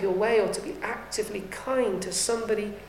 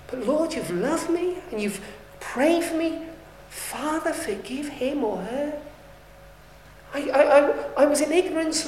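higher, earnest plea for mercy; a repentant sinner pleading higher for forgiveness; pleading prayer; 4 semitones higher than usual. Acted. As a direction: beg the Lord for forgiveness, pitched up. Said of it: "come to fall before him and say".

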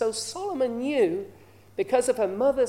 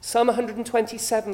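usual speaking voice

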